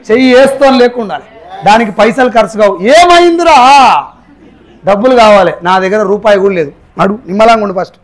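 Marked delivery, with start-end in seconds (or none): 2.8-4.1 drawn-out concern. Acted. Asking a troubled friend.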